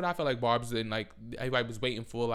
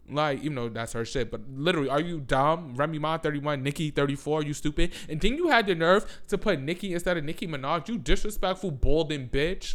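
loud and higher, name-calling; furious rant; 4 semitones higher, 6 dB louder than usual. Real, not acted. Roasting Ebro straight to camera, furious rant. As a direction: tear into him.

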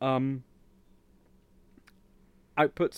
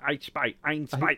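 put-on German accent; 2 semitones higher, slower than usual. Mimicking the YouTuber's name.

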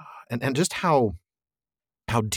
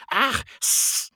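high yelp trailing into a hiss; Gollum. A narrator's reading. Creature recoiling at the names, high hissing cry. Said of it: "said Gollum".

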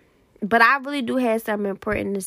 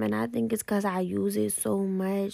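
normal talking voice

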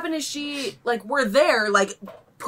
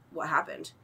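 low, soft deadpan question; flat-out unbothered, quiet reply; blank waitress; 6 semitones lower, 10 dB quieter than usual. Retold or imitated. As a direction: imitate an oblivious server. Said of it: "she just goes".

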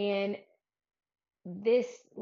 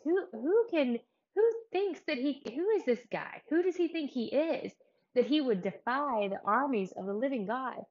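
high, emphatic, incredulous questioning; acted indignation; 5 semitones higher, 5 dB louder than usual. Retelling David's outrage at Goliath defying Israel, indignant. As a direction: demand who on earth dares, pitched up.

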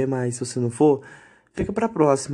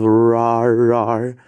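imitando um rosnado de [name], mais alto; rugido da [name]; 3 semitones lower, 6 dB louder than usual. Retold or imitated. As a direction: rar felino de diva pop. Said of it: "a [name] chegasse no seu ouvido e falava". (imitação leve do rar da [name])

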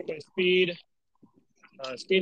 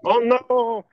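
mock dismay; 7 semitones higher, 7 dB louder than usual.

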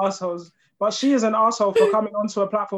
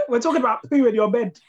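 normal talking voice

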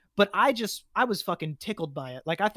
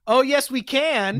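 cocky shout back; 7 semitones higher, 7 dB louder, slower than usual. Acted. Noodle shouting back at Elite.

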